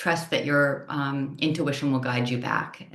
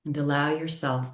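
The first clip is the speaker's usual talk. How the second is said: unhurried yoga nidra guidance, long pauses between phrases; calm, measured meditation voice, each phrase settling downward; guided meditation. Real, not acted.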